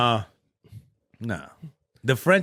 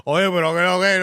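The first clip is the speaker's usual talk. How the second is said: higher, louder rapid Spanish chatter; mock living-room chatter, pitched up; loud Dominican moviegoers; 5 semitones higher, 5 dB louder than usual. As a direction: imitate chatty Dominicans talking over a film. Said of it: "talking like they in the living room".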